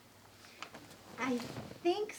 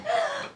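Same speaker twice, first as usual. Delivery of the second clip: excited gasp; 13 semitones higher, 5 dB louder than usual. Acted. Bouncing on hotel bed.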